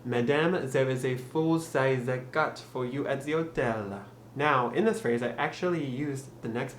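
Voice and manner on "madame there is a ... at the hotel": slow French-accented English, silent H in hotel; deliberate French pronunciation, H dropped. Shown as a demonstration. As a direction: demonstrate a careful, thick French accent. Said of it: "with the French pronunciation".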